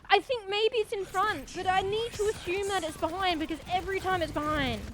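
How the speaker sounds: high, loud outburst easing slightly; frustrated yelling. Real, not acted. Streamer raging at the soldier behind, loud frustrated yelling. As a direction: shriek for help, then rant at full voice. Gamer yells after being attacked.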